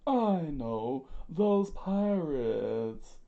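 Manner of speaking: loud, high, stretched-out words; dragged-out theatrical panic; freaking out. Acted. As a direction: wail it slowly in alarm. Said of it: "Mister Two freaked out".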